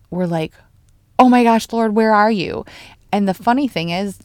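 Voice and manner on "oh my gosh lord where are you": louder, higher cry for help; mock panic. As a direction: call out to God in acted alarm. Acts out panic after diagnosis.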